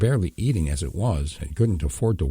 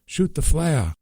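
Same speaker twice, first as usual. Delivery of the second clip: higher, urgent yell; wounded father urging her to fire, mild yell; 10 semitones higher, slower than usual. A narrator's reading. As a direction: bark the order at her. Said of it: "Lee yelled".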